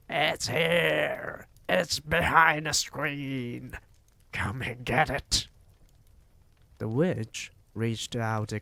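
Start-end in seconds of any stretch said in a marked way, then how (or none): 0.1-5.5 a witch's face yelling in high glee; triumphant yell. A narrator's reading. Witch crowing to the others she found the boy, loud and triumphant. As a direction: crow it out, you've found the boy. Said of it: "yelled triumphantly".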